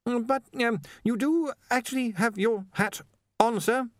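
high, halting objection; hesitant underling. A narrator's reading. Ponder objects to lending his hat, hesitant. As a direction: point it out timidly.

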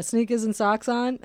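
ordinary talk, nothing marked

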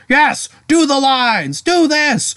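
high, slow theatrical command; Ozymandias. Retold or imitated. Retelling Ozymandias ordering his butler through the play, hammed-up command. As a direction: imitate a grandiose director urging on his actors.

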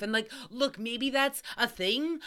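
usual speaking voice

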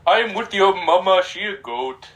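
hammed-up Indian caller, starting high; silly Indian accent, falling off at the end; mock Indian accent. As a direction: join the bit with a put-on Indian accent. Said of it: "I hear some Indian accent".

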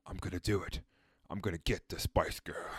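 low, soft, lightly villainous mimicry; evil tabloid guy. Friend imitating tabloid boss in rainy office, low mock scheming. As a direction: say it low and quiet, a hint of villain. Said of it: "He's like".

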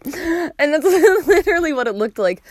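laughing through the words. Real, not acted. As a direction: giggle while saying it. Laughing at the scooped bagel.

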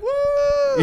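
high, loud whooped "woo"; crowd cheer. Retold or imitated. Imitating the crowd at the show, loud high cheer. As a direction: whoop like an excited audience. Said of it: "everybody's like".

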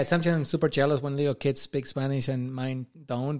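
ordinary delivery

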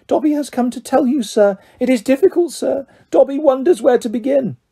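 high, humble house elf; earnest little creature, pitched high; Dobby, earnest. A narrator's reading.

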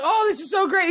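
high, bright-eyed gush about New York; gushing newcomer. Retold or imitated. Newcomer gushing over his month in New York, high and excited. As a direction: gush like a starstruck tourist. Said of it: "I was like".

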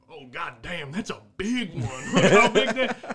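loud, high mock marveling, breaking into laughter; whooping good-ol'-boy admiration; bragging fisherman. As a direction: gloat over a prize catch, hammed up. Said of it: "like how people do fish".

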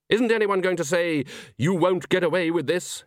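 high, theatrical prompting; mock incredulity. Villain prompts his silent audience.

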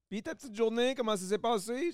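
put-on sweet talking to a child; chatty bus driver. Mimicking bus driver with kids.

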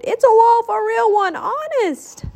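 high-pitched pleading alarm; desperate high insistence; panicked shout. A narrator's reading. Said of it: "Donald shouted".

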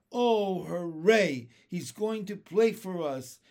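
loud, jubilant cheer; delighted cry. Acted. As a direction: cheer with glee at the news. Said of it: "they cried".

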